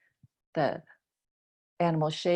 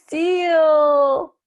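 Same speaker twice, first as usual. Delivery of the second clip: higher, louder answer; excited reveal; 8 semitones higher, 8 dB louder than usual. Real, not acted. Teacher announcing the answer seal, excited. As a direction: announce it with delight.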